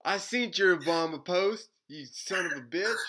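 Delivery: loud, high Southern scolding; mock Southern accent. Circus Masons confronting him over a post, mock Southern accent. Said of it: "I don't know why they got Southern".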